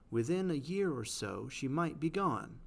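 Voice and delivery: "Within a year or so, she might be gone" with every second syllable underlined by the voice; stressed iambic reading. Shown as a demonstration. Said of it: "underline the parts that are stressed".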